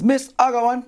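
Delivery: high-pitched pupil calling out to teacher; child's voice, pitched well up; little schoolgirl. Acted. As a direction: pipe up from the back of class. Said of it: "little Stephanie in the back says".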